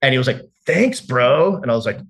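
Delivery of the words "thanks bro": high, loud, gushing imitation of the roommate; mock him thanking you too eagerly; hammed-up over-the-top gratitude; overeager thanks. Roommate voiced in retold scene.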